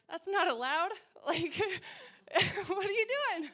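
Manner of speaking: high, loud, laughing telling-off; mock scolding. Wife to husband hurt at work, mock scolding. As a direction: scold him in playful disbelief.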